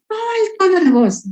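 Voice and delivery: high, mocking "Oh, el tono de voz" with a sneer; mocking echo. Defense lawyer mocking the prosecutor's objection, scornful high echo. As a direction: throw her words back at her in scorn. Y hasta la juez se rió.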